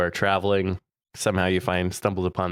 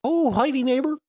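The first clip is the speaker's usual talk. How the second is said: very high, cartoonish neighbor voice; squeaky high voice. Playful neighbor greeting bit.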